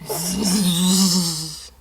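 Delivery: long droning zzz; snore. Acted. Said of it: "snored Santa".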